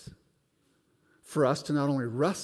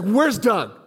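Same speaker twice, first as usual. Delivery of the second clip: frantic demand; 7 semitones higher, 7 dB louder, slower than usual. Retold or imitated. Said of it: "He's like".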